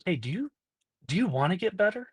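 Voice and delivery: lifted, rising question, lightly voiced counselor; counselor's gentle question. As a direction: coax it out like a caring counselor. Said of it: "she's like".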